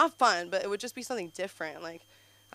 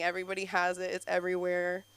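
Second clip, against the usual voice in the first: normal talking voice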